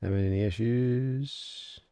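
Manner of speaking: audio cutting out; dropping, broken mic signal; corrupted glitchy audio. Real, not acted. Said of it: "My audio is cutting out".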